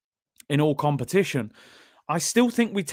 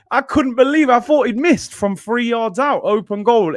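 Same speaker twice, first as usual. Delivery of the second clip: incredulous; 8 semitones higher, 4 dB louder than usual. Real, not acted. Reliving his shock at near miss.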